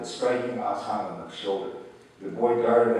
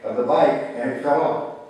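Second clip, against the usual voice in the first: higher, louder pleading insistence; boy defending himself to grandfather, higher and insistent; defensive protest; 5 semitones higher, 6 dB louder than usual. A narrator's reading. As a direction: protest your innocence. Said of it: "argued defensively".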